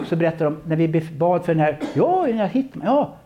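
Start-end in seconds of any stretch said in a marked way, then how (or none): 1.9-3.2 excited. Retold or imitated. Retold men pointing him out.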